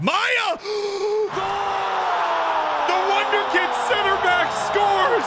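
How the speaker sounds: goal celebration shout. Real, not acted. Streamer celebrating his youth team's goal, ecstatic shout. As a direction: scream the goal like a commentator.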